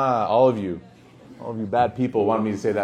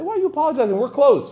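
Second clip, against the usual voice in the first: very high, hammed-up disbelief; squeaky-high acted protest, getting louder; mock incredulous protest; 6 dB louder than usual. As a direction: play a friend waving off an apology, voice shooting up. Acts out friend protesting an apology.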